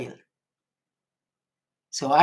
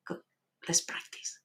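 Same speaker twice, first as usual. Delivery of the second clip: whispering; 12 dB quieter than usual. Real, not acted. Starting the breathing exercise.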